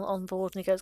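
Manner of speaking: ordinary talk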